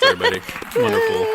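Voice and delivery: wonderful said through laughter, high; laughing delighted Wonderful. Real, not acted. Laughing praise after reading.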